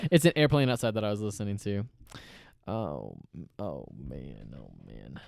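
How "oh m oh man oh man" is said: sinking lower and softer to near nothing; fading dismay. Real, not acted. Host trailing off about a noise, fading sigh. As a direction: let it fade away, deflated. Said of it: "fading sigh of dismay".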